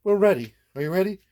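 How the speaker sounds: ordinary delivery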